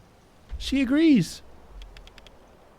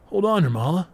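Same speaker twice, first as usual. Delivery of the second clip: ordinary talk, nothing marked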